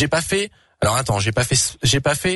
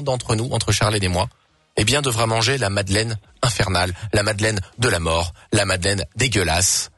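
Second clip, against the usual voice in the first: mock ominous; 7 semitones lower than usual. Announcing the game's punishment.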